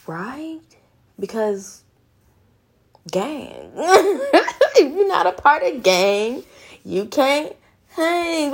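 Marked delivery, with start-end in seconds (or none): 3.7-8.5 slow, laughing through the words, higher. Real, not acted. Laughing gang joke.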